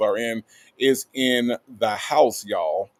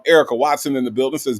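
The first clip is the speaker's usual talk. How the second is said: ordinary talk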